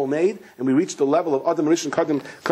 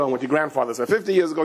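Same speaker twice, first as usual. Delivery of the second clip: plain reading voice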